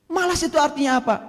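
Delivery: ordinary delivery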